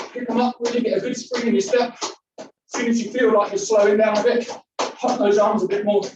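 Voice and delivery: energetic fitness instructor urging on; fired-up workout coaching. Acted. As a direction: drive the class on through the burn. Pumps up the class.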